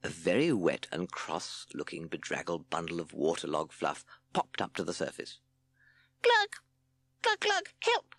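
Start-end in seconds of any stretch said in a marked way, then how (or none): 6.2-8.1 very high gurgled squeals; drowning shout. A narrator's reading. Chick sinking in the water, high squealed shout. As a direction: squeal as if going under. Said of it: "it shouted".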